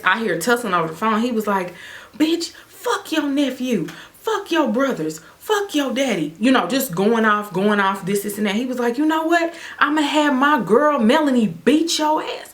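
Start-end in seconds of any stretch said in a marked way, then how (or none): 2.2-6.3 ex going off. Retold or imitated. Ex curses her family on the phone, high ranting voice. 9.0-12.5 high taunting voice for the ex; ex's threat. Retold or imitated. Ex threatens to send Melanie, high gloating taunt.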